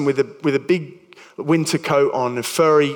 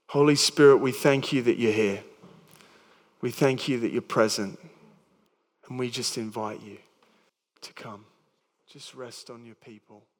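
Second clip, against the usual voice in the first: soft, hushed prayer fading to almost nothing; quiet prayer; 3 semitones lower, 6 dB quieter than usual. Acted. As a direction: pray gently, letting each line sink lower and softer. Closing prayer over the congregation.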